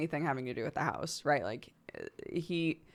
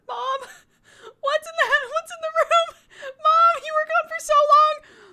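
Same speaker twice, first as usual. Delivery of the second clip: whiny scared cry; 9 dB louder than usual. Retold or imitated. Daughter wailing at her mom, a high, loud, crying whine. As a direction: wail like a frightened child left alone.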